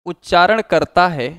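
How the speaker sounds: teacher dictating notes, one phrase at a time; dictation pace. Shown as a demonstration. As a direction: read it out phrase by phrase for students to write down. Dictating the definition of Sangam.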